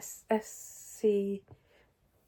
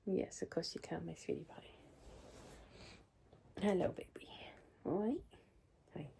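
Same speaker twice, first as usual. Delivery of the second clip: soft, tender cooing to the cat; soft pet talk; 4 semitones higher, 9 dB quieter than usual. Real, not acted. Speaking softly to her cat, tender coo. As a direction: coo gently to your sweetie pie.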